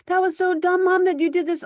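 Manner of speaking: put-on high, loud whiny teen jab; mimicking a teenager insulting mom; mock kid yelling. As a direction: shout it like a bratty kid.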